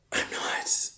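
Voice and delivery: broken whisper; barely voiced denial; real hushed whisper. Real, not acted. Grieving, breaks into whisper.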